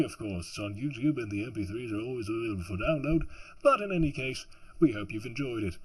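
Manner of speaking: loud, upbeat presenter voice; announcer sign-off. Acted. Reader signing off to audiobook listeners, announcer voice. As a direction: present it grandly, with joking asides.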